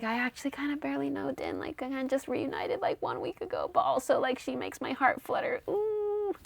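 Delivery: high, soft, flustered squeak; freaking out. Retold or imitated. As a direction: panic privately in a small high voice, melting into a swoon. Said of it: "she starts freaking out".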